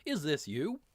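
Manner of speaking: hammed-up foreign officer's accent; English accent in German. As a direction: question a prisoner in a mixed English and German accent. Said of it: "how can you do an English accent in German".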